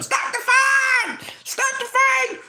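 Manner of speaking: very high, slow squealing yell; mock frantic yell. Andre Dirrell yelling from outside, hammed-up squealing shout. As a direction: ham up a panicked shout.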